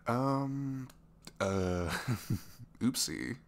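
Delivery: loud, drawn-out hesitations with a nervous laugh; embarrassed little oopsie; sheepish fluster. Acted. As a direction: stall awkwardly after being caught out. Embarrassed after the slip.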